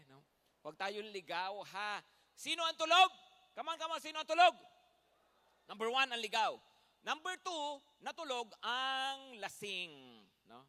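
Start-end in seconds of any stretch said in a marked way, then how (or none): 2.3-4.6 loud rousing shout to the congregation; calling out to sleepers. Real, not acted. 5.7-9.9 loud point. Real, not acted. Preacher punching the point.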